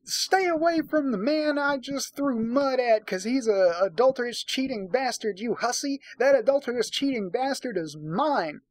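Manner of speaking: high, mock-furious wife telling off the other woman; shrill jealous wife. Reader voicing Ruby scolding the other woman, mock furious. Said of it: "points at the woman he's with and is like".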